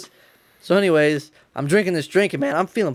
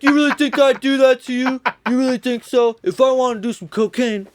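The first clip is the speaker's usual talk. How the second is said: lightly voiced dad, higher and mock hurt; a hint of an indignant father; offended dad; 6 semitones higher than usual. Dad protesting to his son, lightly voiced mock offense.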